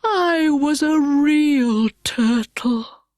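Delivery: Mock Turtle sighing over his past; sighing sorrow. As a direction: sigh it out as if heartbroken. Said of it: "with a deep sigh".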